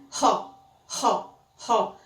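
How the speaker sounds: breathy H at the start of "Ro", like the R in rato; English H sound. Shown as a demonstration. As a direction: demonstrate "Ro" with an aspirated H onset. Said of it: "parecido com o nosso R de rato".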